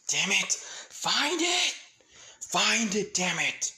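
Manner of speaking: frantic, high shouted command; urgent shout. Acted. Elijah urging the others to find the demon glyph, urgent shout. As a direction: shout it out in desperation. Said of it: "Elijah saying".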